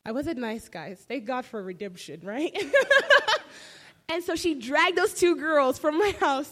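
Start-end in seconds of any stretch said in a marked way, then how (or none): 2.6-4.0 real burst of high laughter; loud high laugh. Real, not acted. Laughing at her past self to the congregation, loud high laugh. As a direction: let a loud laugh break out.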